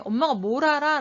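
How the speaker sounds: acted child belittling mom; contemptuous. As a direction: throw it out with contempt. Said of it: "무시하는 느낌이 확 들잖아요".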